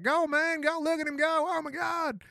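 put-on high, loud Southern accent yelling at the race; hollering like a Southern NASCAR fan, high and loud. Neighbor cheering a NASCAR race, shouted in a Southern accent. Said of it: "people across the street with Southern accents".

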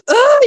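high, loud frustrated groan of a parent; a parent's fed-up outburst; exasperated oh. Retold or imitated. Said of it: "You're just like".

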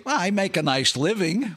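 lightly played wisecracking comic; idiot comic. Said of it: "as an idiot comic, what do I say?".

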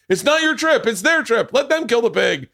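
high, insistent imitation of a director; retold director's order. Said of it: "I hear the director go".

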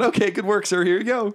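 high, squeaky courier voice; obliging little messenger; delivery runner. Acted. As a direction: hand it over in a piping voice.

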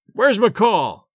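understated yell of an irate ballplayer; angry yell. A narrator's reading. The Rube hunts the train for McCall, mild angry yell. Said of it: "he yelled".

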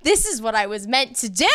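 thrilled. Retold or imitated. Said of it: "I literally am like".

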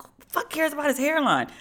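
high-pitched incredulous comeback; exasperated disbelief. Retold or imitated. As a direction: dismiss a silly objection. Acting out her exasperated reply.

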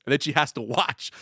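ordinary talk, nothing marked

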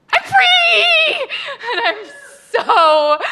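high, loud joyful scream; ecstatic shout. Retold or imitated. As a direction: burst in shrieking with relief. Bursting in to tell Morel.

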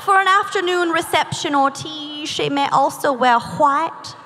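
prim mid-Atlantic etiquette reading; affected mid-Atlantic recitation; mid-Atlantic accent. Acted. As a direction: read it like a quote in a put-on mid-Atlantic accent. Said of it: "I don't know what accent this is".

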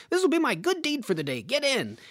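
a lightly voiced kindly old man, quick and cheerful; sweet old man. Old man offering a stranded girl a ride, cheerful. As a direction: offer the help like a friendly old-timer. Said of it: "this old man was so sweet".

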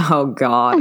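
ordinary talk

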